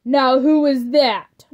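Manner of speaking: slow, loud yelled question. A narrator's reading. Dracula yelling toward the door, slow loud demanding question. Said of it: "Dracula yelled".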